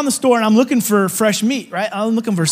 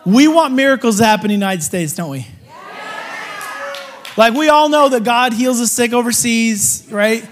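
higher, louder rallying preacher call; full-force preaching; 4 semitones higher, 4 dB louder than usual. Acted. Preacher rallying the church for miracles at home, rousing and loud. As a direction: stir the crowd, push each line up.

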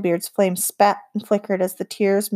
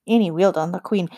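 plain reading voice

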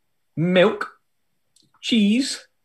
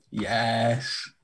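ordinary talk

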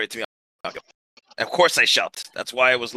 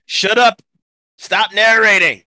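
shouting; 7 semitones higher, 7 dB louder than usual. Acted. Character yells at narrator.

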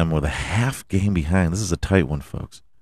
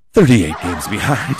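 collapse laughing at the number. Real, not acted. Bursts into laughter.

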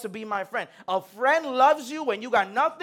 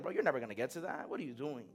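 mocking friend; 8 semitones lower, 13 dB quieter, faster than usual. Mocking friends, much lower, softer.

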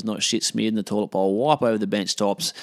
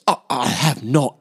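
mock-offended, slow and higher; indignant denial; 4 semitones higher, 3 dB louder, slower than usual. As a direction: imitate an affronted woman, drawing it out. Retold denial in an argument.

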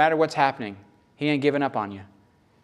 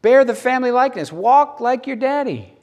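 normal talking voice

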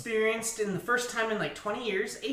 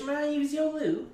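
higher, prim British request; mock British accent; 6 semitones higher, 4 dB quieter than usual. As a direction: ask politely like a proper Brit. Joking British request for the loo.

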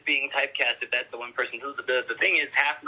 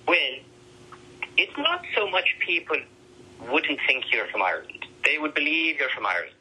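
lightly voiced Irish cousin, higher and amused; cousin's Irish accent; 5 semitones higher than usual. Cousin Eamon teases him about his accent, in a light Irish lilt. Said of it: "my cousin Eamon looks at me like".

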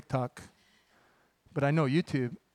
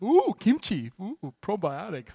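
mock trendy foodie; 3 semitones higher, slower than usual. Pastor mocking people who now love kimchi, cooing mock delight. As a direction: coo over it like a trend-chaser. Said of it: "you're like".

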